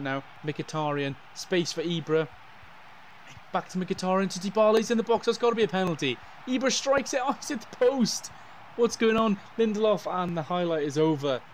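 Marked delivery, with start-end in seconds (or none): 3.5-9.2 rising, louder play-by-play as the chance builds; building commentary. Acted. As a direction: call the attack climbing in pitch toward the shot.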